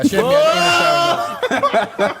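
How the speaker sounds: cracking up at his own line; laughing while he says it. Real, not acted.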